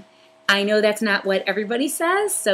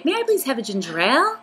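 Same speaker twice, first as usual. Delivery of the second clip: bright, higher, sweetly polite ordering; polite request; 6 semitones higher, faster than usual. Shown as a demonstration. Vlogger showing how she orders a soda, sweetly polite. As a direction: ask nicely like at a counter. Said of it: "I would say".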